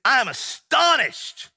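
high, loud and slow, outraged; Paul's indignant cry to the church; astonished outburst. Shown as a demonstration. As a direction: let the shock burst out. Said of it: "He is upset".

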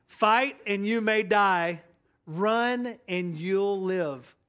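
slow, forceful battle oratory; bold declaration. Retold or imitated. Pastor quotes Wallace answering a fearful soldier, slow and bold. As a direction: draw out each phrase boldly for the troops. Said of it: "Wallace responded boldly".

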